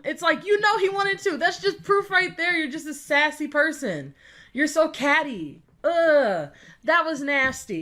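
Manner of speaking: exasperated. Real, not acted. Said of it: "laughing disbelief".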